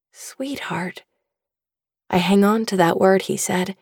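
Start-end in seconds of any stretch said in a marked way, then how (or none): 0.1-1.0 whispering. A narrator's reading. Remembered father's word, whispered.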